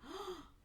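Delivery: quick high intake of breath; mock shocked gasp. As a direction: imitate a startled gasp. She drops the tea in shock.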